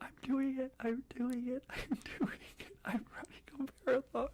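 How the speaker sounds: high, breathless and thrilled; laughing disbelief, pitched high; giddy excitement. Retold or imitated. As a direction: relive the joy of finishing. Running to the finish line.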